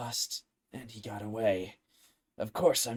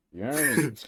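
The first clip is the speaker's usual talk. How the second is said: heroic character voice; 2 semitones higher, much faster than usual. Shown as a demonstration. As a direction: say it like the game hero. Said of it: "That's the voice you're going for".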